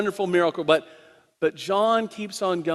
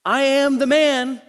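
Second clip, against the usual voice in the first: insisting blind man; 7 semitones higher, 3 dB louder than usual. Acted.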